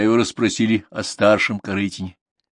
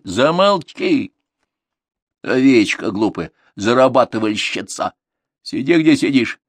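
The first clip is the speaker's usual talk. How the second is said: sharp scold; 4 semitones higher, 4 dB louder than usual. Acted. Mother silencing daughter.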